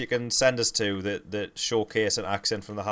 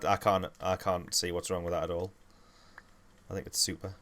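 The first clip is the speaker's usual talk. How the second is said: ordinary delivery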